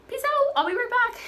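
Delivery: high, chirpy mock-casual send-off; breezy goodbye. Girl breezily leaving the house, high and chirpy. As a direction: toss it off lightly, pitched high. Cheery exit line to mom.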